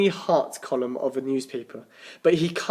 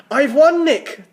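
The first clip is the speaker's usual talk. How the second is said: higher and louder, breathless with joy; gasping with excitement; 12 semitones higher, 9 dB louder, slower than usual. Acted. Tim tells Nick he won Paris, gasping excitedly. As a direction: gasp out the win, can't believe it. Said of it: "Tim gasped".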